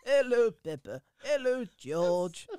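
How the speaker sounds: slow grandfather voice greeting Peppa and George; Grandpa Pig impression. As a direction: greet the children like a kindly old pig. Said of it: "I do Grandpa Pig".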